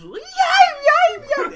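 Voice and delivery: loud, very high puppy yelps; imitated dog yelps. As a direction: yelp like a frightened little dog. Imitating a grabbed teacup dog's yelps.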